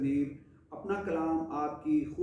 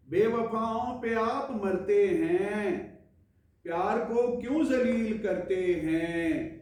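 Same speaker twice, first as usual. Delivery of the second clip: mushaira-style poetry recital, higher than chat; ghazal recitation; 5 semitones higher, 4 dB louder than usual. Acted. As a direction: recite each couplet with measured pauses. Recites second ghazal to viewers.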